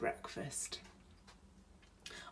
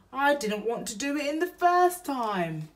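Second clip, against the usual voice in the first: higher, louder hammed-up grumble; burglar voice; 8 semitones higher, 7 dB louder than usual. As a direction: complain like a hurt burglar. Bill grumbles after fall.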